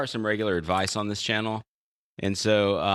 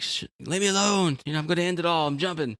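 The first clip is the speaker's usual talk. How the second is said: despairing jumper; 6 semitones higher than usual. Retold or imitated. Jumper voiced in a joke.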